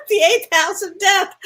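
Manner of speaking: high, loud words through laughter; laughing. Real, not acted. Joking about the chart's grim house, laughing. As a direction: crack up while saying it.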